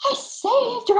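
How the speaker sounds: imitating a thrilled husband crying out, high and loud; excited. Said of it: "He was so excited".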